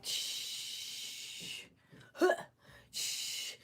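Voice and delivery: imitated hiss and puff of a hot flat iron; breathy sizzle noises like hot hair; mock sizzle sound; straightener hiss. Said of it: "Imagine straightening it and you're like".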